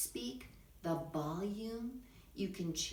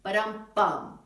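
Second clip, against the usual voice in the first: a punchy spoken ba-dum-bum, louder; ending sting; 10 dB louder, slower than usual. Shown as a demonstration. Teacher showing how a monologue ends, mock sting. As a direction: tap out a rimshot finish with your voice. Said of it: "like a".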